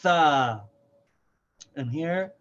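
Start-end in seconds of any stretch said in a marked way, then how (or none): 0.0-0.6 Tha said loud and drawn out; letter name Tha. Shown as a demonstration. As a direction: announce Tha clearly. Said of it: "this is called".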